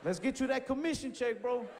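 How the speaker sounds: high-pitched, mock-salesman hype; hyped-up challenge. Acted hyped-up challenge to the cashier.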